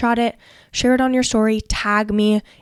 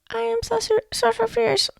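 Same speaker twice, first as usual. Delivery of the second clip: unsure mock voice; 12 semitones higher, 5 dB quieter than usual. Shown as a demonstration. Mocking a [name] who doubts herself, high and hesitant. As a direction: squeak the name out unsure of yourself. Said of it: "No, she doesn't".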